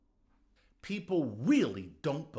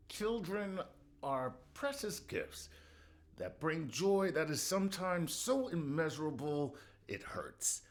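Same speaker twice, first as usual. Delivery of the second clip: mock sentimental; 3 semitones higher, 3 dB quieter than usual. Mock sentimental greeting card read.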